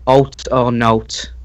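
"Alt or note" drawn out very slowly; stretched-out "Alt or note" for a confused listener; slow, careful repeat. Shown as a demonstration. Repeats dialect phrase for confused listener.

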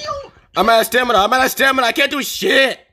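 high, panicky wail; panicked complaint. Real, not acted. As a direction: cry out high in helpless alarm. Distress, out of stamina in game.

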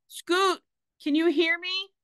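high, urgent shout. A narrator's reading. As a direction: shout down again, pleading for an answer. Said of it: "Skelly shouted again".

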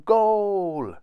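high, loud drawn-out cheer; triumphant shout. A narrator's reading. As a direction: celebrate scoring with a shout. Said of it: "he shouted".

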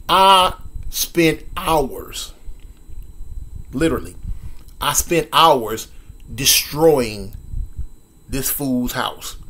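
savoring each word slowly; gleefully slow confession; slow, drawn-out emphasis. Real, not acted. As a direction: linger on every word to relish it. Savoring revenge.

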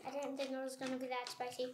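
fast, barely audible mumble; very quiet. Real, not acted. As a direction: mumble it under your breath. After eating hot pepper.

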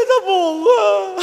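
slow, fake sobbing through laughs; hammed-up drunk sob; mock crying. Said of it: "Sao mày khóc?".

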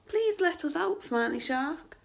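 high storybook plea, easing at the end; pleading cry. A narrator's reading. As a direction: plead like trapped fish calling for rescue. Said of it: "cried the fish".

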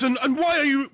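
normal talking voice

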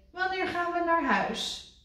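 high whiny frog voice; Kikker whining. A narrator's reading. Kikker begging Rad, high whine. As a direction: plead to go home.

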